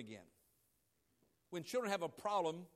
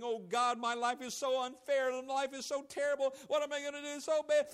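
louder, higher mock complaining; complaining whine; 5 semitones higher, 7 dB louder than usual. Believer complaining to God, mock whining. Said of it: "We begin blabbering and murmuring and complaining".

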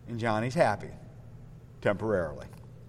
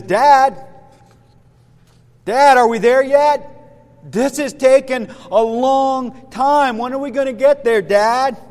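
higher, louder whining-kid impression; impatient child; 5 semitones higher, 5 dB louder than usual. Little Johnny nags dad on a long trip, impatient child. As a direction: play a kid pestering from the back seat.

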